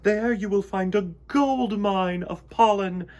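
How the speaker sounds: high, loud royal proclamation; queen announcing news to her court; grand announcement. A narrator's reading. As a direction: announce it to the whole hive. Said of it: "she announced".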